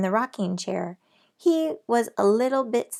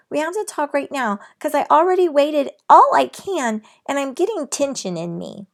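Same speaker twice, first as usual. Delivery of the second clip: insistent pleading; 7 semitones higher, 6 dB louder than usual. A narrator's reading. Girl insisting to dad.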